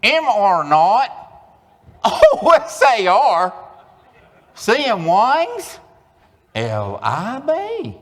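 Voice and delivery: high, slow, loud twang; exaggerated Oklahoma twang; Oklahoma twang reading. Shown as a demonstration. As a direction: spell it out like a proud local. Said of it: "read that aloud with me".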